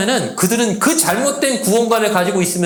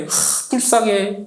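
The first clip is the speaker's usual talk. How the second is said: pitying sigh; much slower than usual. Retold or imitated. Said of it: "불쌍해 여겨요".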